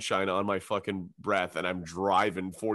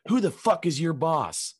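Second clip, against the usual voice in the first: higher, drawn-out demand; confrontational; 7 semitones higher, 4 dB louder, slower than usual. Retold or imitated. Woman at the bar challenging a stranger, confrontational. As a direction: demand an answer, stretching the words.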